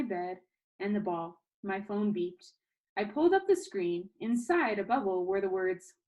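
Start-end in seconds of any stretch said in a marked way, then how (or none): none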